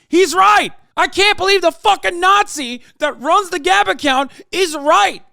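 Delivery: furious outraged shout; angry yelling. Real, not acted. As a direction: yell it like you can't believe it.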